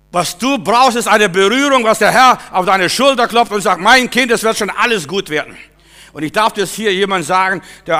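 loud, high sermon appeal; fervent loud preaching; full-force preaching. Acted. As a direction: preach it out with fire.

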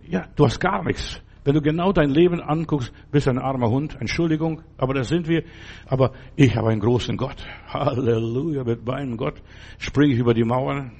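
ordinary delivery

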